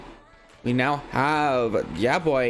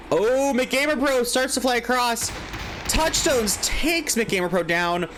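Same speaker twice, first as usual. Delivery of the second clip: excited; 9 semitones higher than usual. Real, not acted. Sudden play in live cast.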